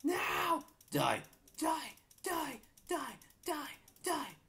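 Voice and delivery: high shouted die; battle screaming. Real, not acted. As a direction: scream at the enemy. Fighting mob, screaming.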